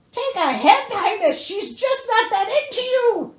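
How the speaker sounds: high, loud teasing quip; sassy mock advice. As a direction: toss it off like a sarcastic friend. Mocking aside to the prince.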